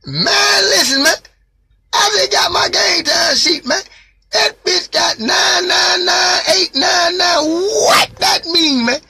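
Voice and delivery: high, loud, half-sobbing plea; crying down the vent about the sheet; crying shout. Retold or imitated. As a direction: beg for an answer through tears. Retelling crying over the sheet.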